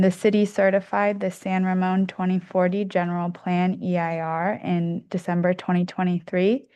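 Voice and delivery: usual speaking voice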